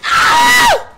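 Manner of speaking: piercing loud shriek; high-pitched scream. Real, not acted. Screams during the game.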